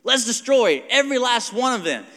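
high, loud battle cry from a king; King Saul's war cry. Retold or imitated. King Saul urging his men on, loud and high. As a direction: call for total slaughter at full voice. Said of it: "This was his idea".

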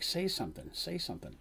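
hushed, prodding say something; urgent whisper. Acted. Darsh nudging Dandy from behind, hushed and urgent. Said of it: "he's poking her in the back".

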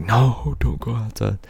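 high-pitched panicky no; imitating their frantic warning; mock alarmed warning. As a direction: voice jumps up in alarm. Both warning friend not to go out.

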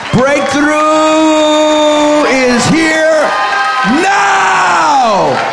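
slow build into a high, drawn-out shout; real revival-preacher climax; building to shouted proclamation. Real, not acted.

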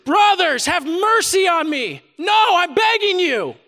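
crying and pleading. Retold or imitated. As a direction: cry out to the brothers, begging for mercy. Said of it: "he would have been crying and pleading".

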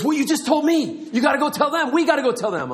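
hammed-up urgent insistence, high-pitched; super excited. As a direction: push your friend eagerly, over the top. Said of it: "He was super excited, a little over the top".

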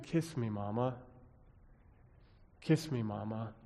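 quiet, soft plea through tears; soft tearful plea. A narrator's reading. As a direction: ask gently as if crying. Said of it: "in a soft voice through his own tears".

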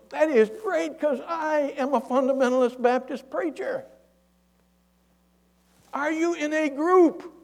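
high, sustained calling voice; yelling. Retold or imitated. Pastor greets a fellow Baptist and quizzes him, yelling. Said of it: "The guy yells".

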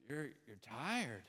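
slow, soft, low, played tired; acted weariness. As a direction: let it sag quietly, as if weary. Voices the congregation's weariness.

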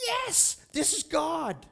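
high, jubilant exclamation; elated cry. Acted. Preacher acting out Peter seeing the Spirit fall, elated. Acting out excited witness at baptism.

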